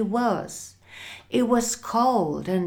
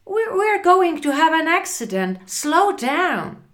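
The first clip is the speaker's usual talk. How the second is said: high, loud, stammering alarm; panicky; 9 semitones higher, 6 dB louder, faster than usual. Acted. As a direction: plead urgently from the back of a car. Frightened passenger begs driver.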